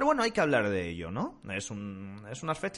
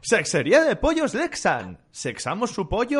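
loud, slow, higher announcer read; mock ad slogan; 8 semitones higher, 8 dB louder, slower than usual. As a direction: sell it like a cheesy radio ad. Anuncio de broma de su tienda. (eslogan publicitario exagerado, lento y alto)

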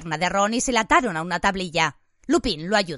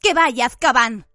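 louder, insistent; firm; 4 semitones higher, 6 dB louder than usual. A narrator's reading. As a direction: insist firmly. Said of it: "repitió Harry".

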